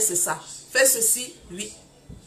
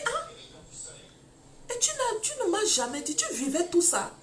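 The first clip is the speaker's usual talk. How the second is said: surprised; 6 semitones higher than usual. Retold or imitated. Her shocked reply retold.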